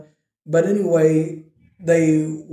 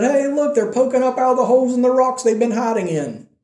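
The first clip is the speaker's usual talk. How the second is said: mocking taunt; 7 semitones higher than usual. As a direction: sneer it down at them from the walls. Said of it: "They're mocking them".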